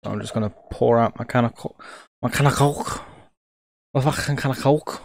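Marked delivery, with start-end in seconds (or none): 2.2-5.0 clumsy attempt at a Liverpool accent; a botched go at Liverpool Scouse; bad Liverpool accent. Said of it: "trying to interpret a very bad, um, Liverpool accent".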